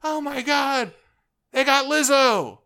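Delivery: very high, loud excited squeal; gushing fan squeal. Acted. Fan reacting to [name] casting news, high squeal. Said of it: "And it's just like".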